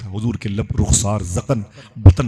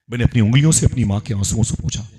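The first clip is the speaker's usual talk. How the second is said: ordinary talk, nothing marked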